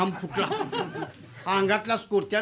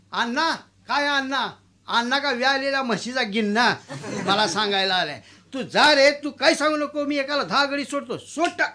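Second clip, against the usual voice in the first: mocking dismissal; 3 semitones higher, 3 dB louder than usual. Landlord mocking the peon and chasing him off, loud. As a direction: shoo him away scornfully.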